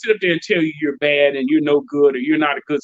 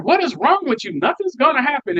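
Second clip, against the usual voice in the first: much higher, mocking wife's disbelief; hammed-up disbelieving wife; exasperated wife; 9 semitones higher than usual. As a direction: squeak it up like the doubting wife. Wife scoffs at his worry.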